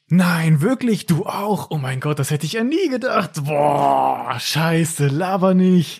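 exaggerated mock delight at a shared interest; fake excitement. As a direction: overplay the thrilled amazement. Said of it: "Klingt aber ein bisschen gefaked".

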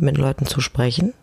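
ordinary delivery

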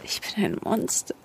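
barely voiced, soft and crushed; broken whisper. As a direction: let it fall out of you in shame. Schuldbekenntnis, verzweifelt. (gebrochen, kaum hörbar)

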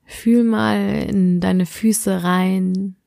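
sleep meditation. Acted. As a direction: guide the listener gently into sleep.

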